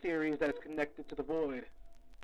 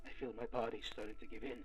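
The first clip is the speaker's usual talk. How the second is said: fading exhaustion; 5 semitones lower, 8 dB quieter, faster than usual. Acted. Exhausted confession.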